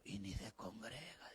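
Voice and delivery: hushed, slow whisper under breath; whispered aside. Retold or imitated. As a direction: mutter it like a secret remark. Pastor quotes himself, whispered aside.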